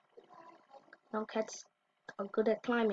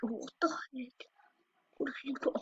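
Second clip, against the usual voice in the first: stunned whisper; 3 semitones higher, 8 dB quieter than usual. Real, not acted. Stunned by the video.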